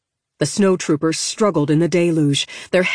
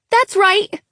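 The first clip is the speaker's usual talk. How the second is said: high, loud brave yell; 4 dB louder than usual. Acted. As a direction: shout it to scare the creature off. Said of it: "Lena yelled".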